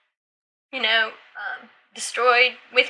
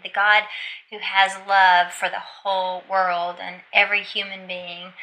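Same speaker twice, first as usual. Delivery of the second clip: usual speaking voice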